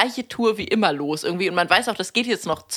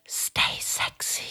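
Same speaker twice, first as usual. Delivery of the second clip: slow, sultry breath of a whisper; hushed, drawn-out come-on, all air; 9 dB quieter, much slower than usual. Acted. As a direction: breathe it seductively at a passing neighbour.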